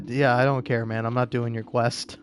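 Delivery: ordinary delivery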